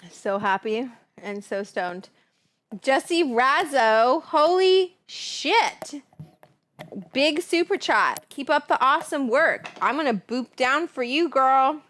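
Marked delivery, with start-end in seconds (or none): 2.8-6.0 drawn-out, high, loud call; yelling. Real, not acted. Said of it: "I'm yelling like you guys are far away". 7.1-11.8 loud, high excited shout-out; yelling. Real, not acted. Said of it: "I'm yelling like you guys are far away".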